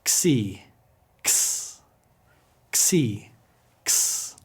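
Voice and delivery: "Xi" as "ksee", then "X" as a voiceless "kss"; KS combination. Shown as a demonstration. Teacher leads repetition, ks xi. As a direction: alternate "ksee" with a hissed k-s. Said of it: "always with a K S combination sound".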